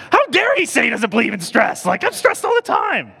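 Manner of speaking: very high, loud outraged rant; angry. Retold or imitated. Preacher re-enacting his anger at the stress-free man, outraged. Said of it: "I was just angry, like".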